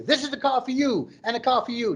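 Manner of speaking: high, excited Oprah shout; mock Oprah giving away cars; Oprah giveaway. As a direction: hand out cars like Oprah, thrilled. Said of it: "you got me all Oprah right now".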